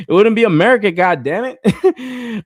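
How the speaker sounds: high, loud, cackling through the words; can't hold in the laughter at his own joke; laughing hard. Real, not acted.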